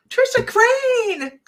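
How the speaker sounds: high, slow, startled cry of the name; alarmed call. Retold or imitated. Hypnotist crying out her subject's name in alarm.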